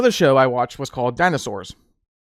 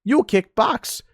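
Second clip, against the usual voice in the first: higher put-on mock kung-fu voice, then slow; clipped, broken-English movie hero bit; Urkel as Bruce Lee; 4 semitones higher, much slower than usual. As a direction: nerd hamming it up as a martial-arts star. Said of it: "Steve Urkel goes".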